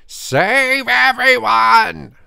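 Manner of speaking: long, drawn-out high yell; loud shout. A narrator's reading. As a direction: bellow it, stretching every word. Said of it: "A shout rang out".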